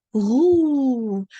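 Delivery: "Roux" loud and drawn out, ending on a long oo; silent x. Shown as a demonstration. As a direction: model "Roux" in French with the x unsounded. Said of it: "you don't pronounce that X".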